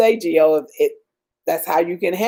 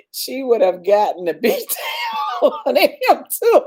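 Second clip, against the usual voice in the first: laughing through words; 4 semitones higher than usual. Real, not acted. Laughing hard at comment.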